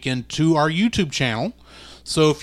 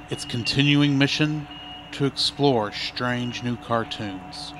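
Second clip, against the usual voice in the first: Star Trek narrator; 4 semitones lower, 5 dB quieter than usual. Acted. Star Trek intro parody.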